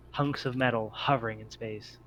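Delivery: normal talking voice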